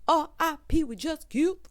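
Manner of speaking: ordinary delivery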